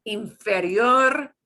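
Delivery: inferior with the final syllable punched; slow inferior, hit the last syllable; stress on the end. Shown as a demonstration. Teacher drilling class, inferior punched hard on the end. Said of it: "we punch the end".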